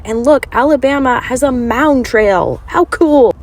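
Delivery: thrilled to find the trail; excited. Real, not acted. Delighted discovery of mound trail.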